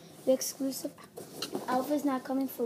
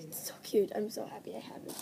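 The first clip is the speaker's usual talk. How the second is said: soft, quick delighted whisper; 4 semitones lower, 5 dB quieter, faster than usual. Real, not acted. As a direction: whisper it to yourself. Whispering over the jacket.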